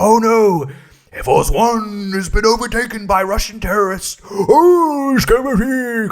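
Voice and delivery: high, loud, drawn-out theatrical wailing; hammed-up movie hero. Mock president on hijacked Air Force One, hammy. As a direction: cartoonishly overact with goofy nonsense cries. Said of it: "being very showy and movie-like".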